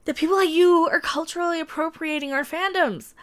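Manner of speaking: high, sneering parody of a fan; high mocking impersonation. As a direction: mimic the fans, taunting. Mocking the article's fans.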